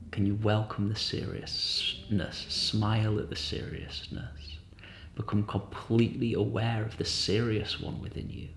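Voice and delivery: steady, even meditation voice with long pauses; guided meditation. Real, not acted.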